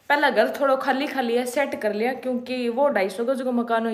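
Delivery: ordinary delivery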